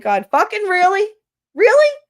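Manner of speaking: loud, incredulous really? climbing very high; mock outrage, demanding an answer from God; exasperated at God. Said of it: "I will sit here and be like".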